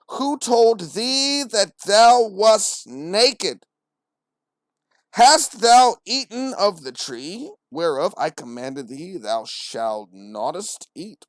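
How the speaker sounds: put-on slow, grandly drawn-out old English; pretentious. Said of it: "why are you so pretentious?".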